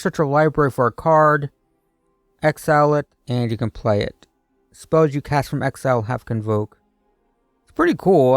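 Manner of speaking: normal talking voice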